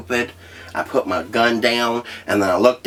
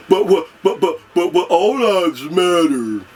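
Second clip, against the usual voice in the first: higher, louder mock stammering; mocking sputter; 9 semitones higher, 4 dB louder than usual. Mocking people who reply all lives matter, sputtering. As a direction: sputter like a flustered objector. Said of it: "other Americans out there going".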